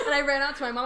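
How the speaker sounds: loud laughing through the words; laughing loudly. Real, not acted. Telling a friend about the lost game, laughing loud.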